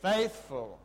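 rally them to shout it; loud instruction. Shown as a demonstration. Teacher telling the kids what to yell, loud call. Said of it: "you will say".

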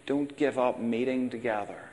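normal talking voice

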